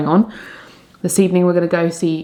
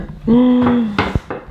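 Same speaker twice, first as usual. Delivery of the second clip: startled gasp; quick gasp of alarm; sharp gasp; 5 semitones higher than usual. Real, not acted. Nearly drops the mince pie.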